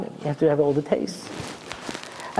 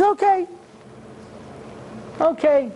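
eager; 12 semitones higher than usual. Acted. Esav eagerly accepting the deal.

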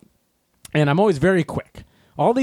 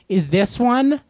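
higher mock fussy shopper, drawn-out question; 6 semitones higher, slower than usual. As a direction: mimic a dithering shopper weighing broccoli. Mocking slow salad-bar shoppers.

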